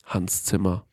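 low, hushed deadpan reply; imitating a quiet, calm friend. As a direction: drop the voice, short and calm. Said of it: "ist er so ganz kurz so ruhig".

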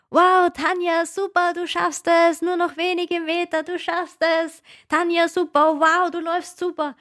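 imitating the crowd egging her on; cheering fans. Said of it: "die Fans an der Strecke geschrien haben".

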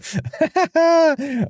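squealing laugh; high laugh burst. Real, not acted. Laugh after the joke.

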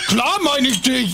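wasted and cheeky; blackout drunk. Acted. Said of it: "der ist lattenstramm". (betrunken und frech)

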